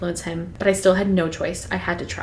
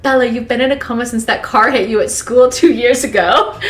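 laughing through a quote; 4 semitones higher, 5 dB louder than usual. Retold or imitated. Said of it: "she was like".

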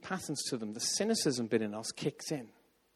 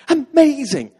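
much higher and louder, thrilled burst; excited exclamation; 12 semitones higher, 16 dB louder, slower than usual. Retold or imitated. Meeting leader hearing he knows nothing, thrilled exclamation. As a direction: blurt it out delighted. Leader reacts then runs off.

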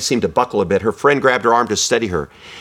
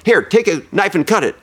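raised, higher mock-gruff urging; lightly voiced rough cook pushing her on; gruff diner cook; 8 semitones higher than usual. Preacher voicing a gruff cook urging Agnes to blow out candles. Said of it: "gruffly said".

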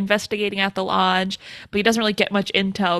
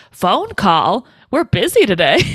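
louder mock amazement with big pitch swoops; imitated joking; 5 dB louder than usual. Deputy teasing sheriff on the phone, imitated joking. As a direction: swoop up and down in feigned astonishment. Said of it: "she's joking".

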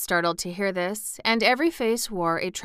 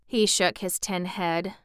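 ordinary delivery, nothing marked

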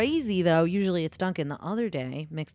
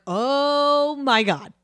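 mock shock; 7 semitones higher, 6 dB louder, much slower than usual. Retold shock at spider.

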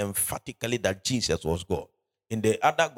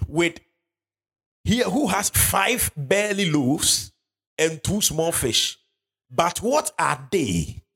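higher, slow scripture reading; 6 semitones higher, slower than usual. Acted. Preacher reading Philip's answer to the church, high and slow. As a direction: raise the voice and drag the verse out. Reads verse nine.

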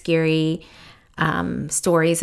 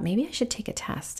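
whispered secret; dropping to a whisper; hushed; 4 semitones higher, 10 dB quieter than usual. Retold or imitated. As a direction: breathe it out like a secret. Quiet realization of pregnancy.

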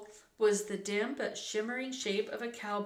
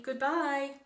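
higher, louder call; shouted goodbye; 6 semitones higher, 5 dB louder than usual. A narrator's reading. Annie shouting goodbye from the canyon top, calling out. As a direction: call the farewell out into the distance. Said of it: "shouted Annie".

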